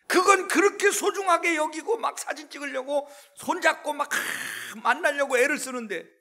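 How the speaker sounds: loud, high preaching climax; fiery preaching. Acted. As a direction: build the sermon to a shout. Preaching at full force.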